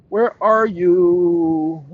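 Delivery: drawn-out, held 'you' as if searching; calling out. Pretend. Streamer hunting for a missing file, calling out. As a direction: call for it very slowly, stretching the last word.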